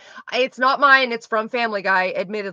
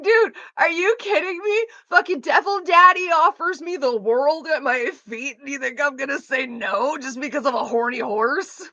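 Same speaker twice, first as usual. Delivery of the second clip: indignant retort; 4 semitones higher than usual. Retold or imitated. Defending Devil Daddy to the boyfriend, indignant. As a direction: argue back as if it were absurd.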